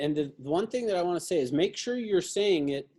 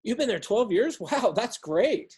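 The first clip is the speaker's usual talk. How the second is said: like you mean it; 4 semitones higher than usual. Shown as a demonstration. Sales coach role-playing a call to affirm an answer, enthusiastic. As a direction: affirm the answer with genuine-sounding excitement. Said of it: "repeat it back like you mean it".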